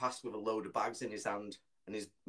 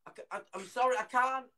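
higher, stammering worry; flustered passer-by; 11 semitones higher, 3 dB louder than usual. Retold or imitated. Bloke failing to find child, imitated flustered apology. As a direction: stumble over it, pitched up and anxious.

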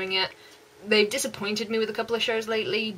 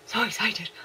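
excited whisper. Real, not acted.

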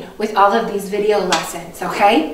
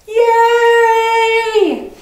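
excited cheer; 10 semitones higher, 3 dB louder than usual. Acted. Mock silent celebration.

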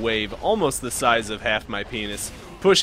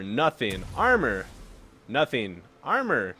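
ordinary talk, nothing marked

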